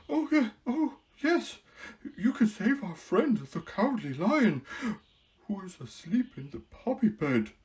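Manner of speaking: Scarecrow, quickly. Acted. Scarecrow eagerly suggesting to the queen, quick and high. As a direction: blurt out the idea. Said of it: "said quickly".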